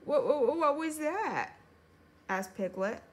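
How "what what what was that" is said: higher, jumpy repeated question; startled stammer. A narrator's reading. Piglet hearing a sudden noise, startled stammer. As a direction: stutter it out in alarm.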